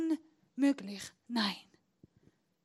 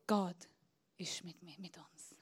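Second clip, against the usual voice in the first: hushed, breathy whisper of faith; 8 semitones lower, 10 dB quieter than usual. Acted. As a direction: lean in and whisper it. Preacher whispers God is with us. (geflüstert)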